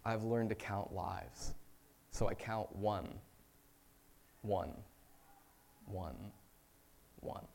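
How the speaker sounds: slow, hushed poem recital, fading away; poem reading. Acted. Reads a poem in sermon.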